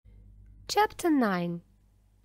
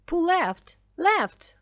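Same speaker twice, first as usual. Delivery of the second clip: high-pitched urgent call; shouted order; 7 semitones higher, 4 dB louder, slower than usual. A narrator's reading. William calling directions to Grace in the boat, shouted. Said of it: "William shouted".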